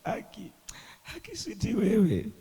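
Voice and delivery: high, laughing and crying in joy; mock tears of joy. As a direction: act out sobbing with happiness. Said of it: "Those are tears of joy".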